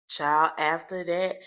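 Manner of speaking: normal talking voice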